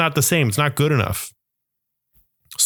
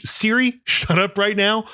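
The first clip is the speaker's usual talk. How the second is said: laughing at Siri; 7 semitones higher than usual. Real, not acted. Phone interrupts him mid-rant.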